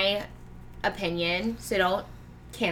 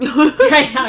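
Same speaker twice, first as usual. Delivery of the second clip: excited; 6 semitones higher, 14 dB louder than usual. Real, not acted. Loud excited agreement.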